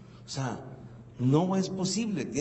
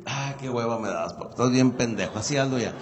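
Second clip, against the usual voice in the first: put-on bored teen; 7 semitones lower than usual. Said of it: "la traducción de X quiere decir".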